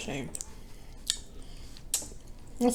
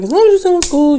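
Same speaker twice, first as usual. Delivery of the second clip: quoting someone; 9 semitones higher, 5 dB louder, faster than usual. Retold or imitated. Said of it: "Someone was like".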